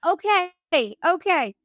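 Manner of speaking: high, eager agreement; ecstatic. Retold or imitated. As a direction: agree eagerly, pitched right up. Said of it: "so fucking ecstatic".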